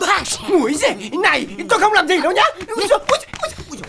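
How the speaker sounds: loud, high comic panic; panicked protest. Acted. Bystander protests to the raging wife, loud flustered panic. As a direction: yelp your innocence while backing away. Man caught up in wife's attack.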